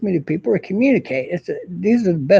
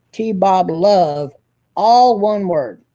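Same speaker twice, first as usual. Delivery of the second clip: slow, deliberate spelling out; 2 semitones higher, 4 dB louder, much slower than usual. Shown as a demonstration. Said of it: "spelling out handle slowly".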